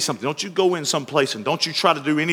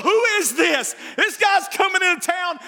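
higher, calling out in wonder; excited townspeople; 14 semitones higher than usual. Acted. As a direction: ask it like a buzzing crowd. Crowd voiced in sermon story.

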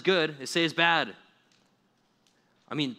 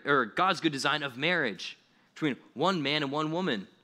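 normal talking voice

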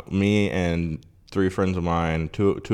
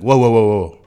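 placating whoa; 4 semitones higher, 11 dB louder than usual. Retold or imitated. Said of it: "And the guy's like".

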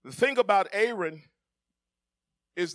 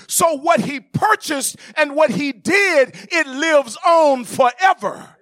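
loud, high, slow preaching climax, every line driven home; preaching at full force; 9 semitones higher, 12 dB louder than usual. Acted. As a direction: thunder it out to the congregation, slow and ringing.

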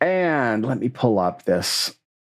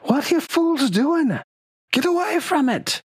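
much higher, piercing warning scream at the fools; screaming NPC. A narrator's reading. Vandy screaming at the fools to flee, high shriek. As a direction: shriek at them to get away. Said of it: "or you hear screaming".

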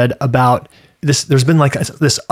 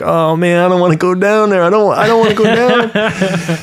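mock dread; 6 semitones higher than usual. His own dread retold.

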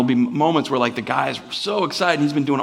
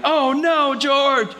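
yelling with daughter; 13 semitones higher, much slower than usual. Retold or imitated. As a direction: cry it out like a kid reading along. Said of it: "my daughter will yell with me".

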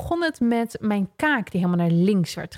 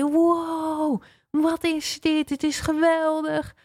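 high, wide-eyed wonder; amazed; 8 semitones higher, much slower than usual. Retold or imitated. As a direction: gasp at everything you see. Amazed on the space station.

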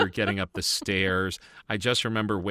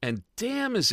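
excited. Real, not acted. Enthusing about show.

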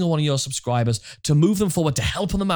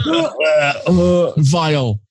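drawn-out, loud mock disgust; 6 dB louder, much slower than usual. Host clowning over a banned "uh", mock disgust. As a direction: groan it out slowly in mock revulsion.

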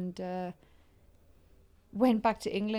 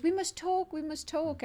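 much higher, insistent plea; pleading Italian; 9 semitones higher than usual. Retold or imitated. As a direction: beg to talk like the lovesick Italian. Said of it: "And he goes".

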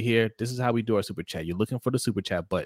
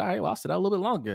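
high, fast offhand mock reply; mock casual Lamar; 10 semitones higher, 3 dB quieter, much faster than usual. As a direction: toss it off casually. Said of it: "Lamar said".

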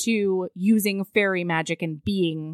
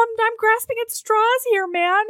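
high-pitched flustered protest; mock desperation; 12 semitones higher, 5 dB louder than usual. Heroine excusing herself to the alarmed hero, high mock desperation. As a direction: answer in a squeaky, hammed-up fluster.

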